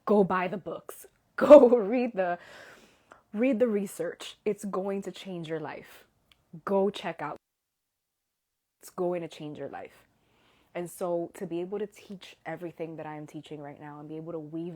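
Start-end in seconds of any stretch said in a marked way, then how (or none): 0.1-10.1 excited urging. Retold or imitated. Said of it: "is like".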